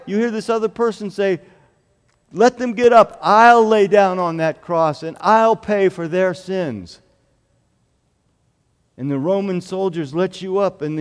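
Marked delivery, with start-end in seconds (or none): 2.3-7.0 loud pleading offer. Acted. Jesus telling soldiers to free the child, loud urgent offer. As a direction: insist they take you instead.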